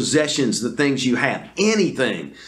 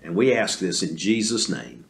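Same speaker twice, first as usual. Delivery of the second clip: closing prayer; 5 semitones lower than usual. Real, not acted. Closing prayer before story.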